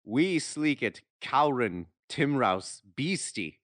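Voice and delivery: put-on loud, high, stumbling read of old Scots verse; sounding out Scottish dialect poetry, loud and amused; reading Burns aloud. Friend reads Burns's poem aloud on a dare, loud and halting. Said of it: "try to read this part of his poem".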